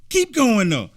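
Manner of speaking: high and loud, thrilled; excited cheering. Real, not acted. Fan cheers the congresswoman's speech, thrilled. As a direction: egg her on with delight.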